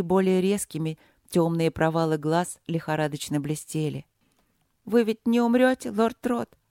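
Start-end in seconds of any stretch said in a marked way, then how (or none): 4.8-6.6 put-on anxious girl. Frightened princess asks.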